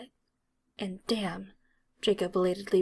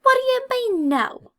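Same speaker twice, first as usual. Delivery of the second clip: much higher, louder disbelieving shout; indignant outrage; 8 dB louder than usual. A narrator's reading. Angry redhead chases Draco, indignant and loud. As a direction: yelp it in disbelief. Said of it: "scrambling to keep up".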